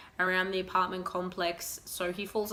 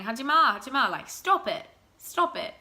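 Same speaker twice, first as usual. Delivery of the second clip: louder, higher stern stop it, rising to a yell; firm scolding shout; 3 semitones higher, 5 dB louder than usual. Retold or imitated. Au pair yelling at a boy crashing into the pram, loud and stern. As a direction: bark the kid off his brother.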